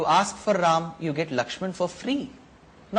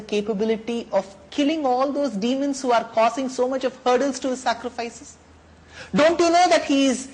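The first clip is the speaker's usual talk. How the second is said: higher, louder guru admonishing; stern priest; 6 semitones higher, 4 dB louder than usual. Acted. Family priest Vasisht sternly instructs Dasharath, higher and louder. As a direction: scold the king with authority.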